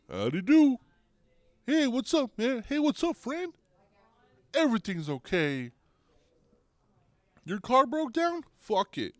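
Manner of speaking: very high, cartoonish friendly purple mascot; squeaky high cartoon buddy greeting everyone; Grimace voice. Comedian voicing Grimace at McDonald's, very high cartoon voice. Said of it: "You got fucking Grimace over there".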